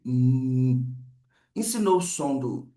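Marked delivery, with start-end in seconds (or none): 0.0-1.0 long, low closed-lip "hum"; sustained humming M; sound of M. Shown as a demonstration. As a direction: hold mmm on one level note. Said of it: "o som do M".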